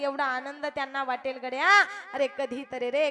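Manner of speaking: normal talking voice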